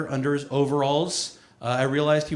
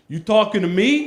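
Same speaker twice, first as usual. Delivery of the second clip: louder, higher New York challenge; 4 semitones higher, 5 dB louder than usual. Acted. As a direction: square up like a New York tough. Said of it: "Which is New York redneck for".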